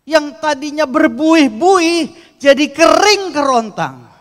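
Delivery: slow, loud, high shout; dramatic shout. Real, not acted. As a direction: drag out kering kerontang with full force. Dramatic climax about dry falls. (teriakan dramatis, pelan dan keras)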